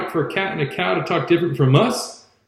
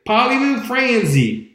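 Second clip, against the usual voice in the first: slow, higher-pitched mock French phrase; 5 semitones higher than usual. Huck showing Jim how a Frenchman talks, mock French. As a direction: ham up the French like a schoolboy. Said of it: "come to you and say".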